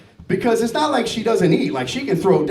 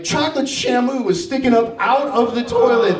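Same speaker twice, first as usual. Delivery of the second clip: ordinary talk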